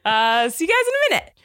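laughing through the words. Real, not acted. Laughing sign-off.

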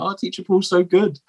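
high-pitched imitation of admiring parents; gushing parent. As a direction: coo it high like a doting parent filming. Voicing admiring parents filming him.

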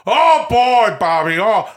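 high, loud hammy holler; Robert De Niro doing Al Pacino. Acted. De Niro quoting his friend Al, loud mock Pacino holler. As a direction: belt out a drawn-out actor impression. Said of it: "This Al Pacino says".

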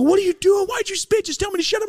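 very high, squealing protest; outraged friend. Retold or imitated. A friend protesting the spit trick, a high squeal. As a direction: whine it up high in disgust. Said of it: "And they go".

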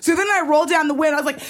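ordinary talk

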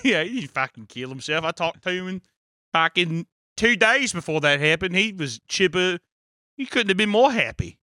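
hammed-up Aussie testimony; attempted Australian accent. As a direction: insist it earnestly in a shaky Australian accent. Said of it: "the Aussie accent's a tough one".

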